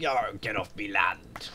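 slow hammed-up pirate growl. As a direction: drag it out like a cartoon pirate. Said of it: "Why did it have to sound like a pirate?".

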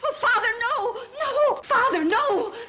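high desperate screaming; terrified scream. Acted. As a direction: scream in terror. Daughter screams at father.

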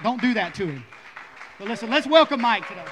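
loud, excited welcome; shouting. Real, not acted. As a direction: whip up the room. Hyping up the crowd for a welcome.

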